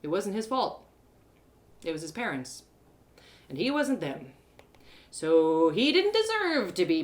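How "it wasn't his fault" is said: ordinary delivery, nothing marked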